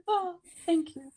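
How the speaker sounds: light mock gush of thanks; touched and moved. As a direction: play a mother moved by her child's words. Mother's touched reply.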